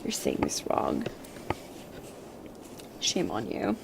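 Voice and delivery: a low, mocking whispered scold; mock critic whisper. Imagined Irish speaker scolding her pronunciation, mock whisper. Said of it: "and be like".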